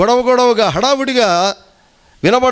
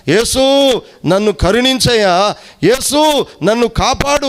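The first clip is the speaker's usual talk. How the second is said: ordinary talk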